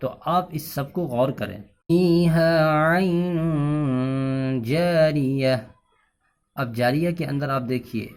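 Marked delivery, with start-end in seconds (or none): 1.9-5.6 very slow, melodic tajweed chant; Quranic recitation. Acted. Teacher recites the lesson verses, slowly chanted. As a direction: stretch every vowel in recitation.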